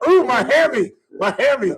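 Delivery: high-pitched yelp of fake pain; hammed-up high wail; mock injured athlete. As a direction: whine like an old ballplayer with a pulled muscle.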